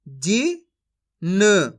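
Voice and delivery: जिन very slowly split as "जी ... न"; syllable by syllable. Shown as a demonstration. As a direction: sound out both letters of जिन separately. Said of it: "एक अक्षर का उच्चारण करके". (जिन को तोड़कर, धीरे-धीरे)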